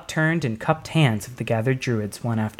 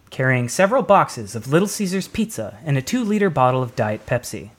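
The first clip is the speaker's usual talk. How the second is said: usual speaking voice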